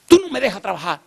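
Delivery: high-pitched mock outburst; retold scolding. Woman complains he is blocking her work, exasperated scolding.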